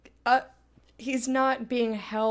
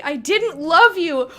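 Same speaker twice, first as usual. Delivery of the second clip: pained outburst; 6 semitones higher, 11 dB louder, slower than usual. Real, not acted. Reacting to romance dialogue option.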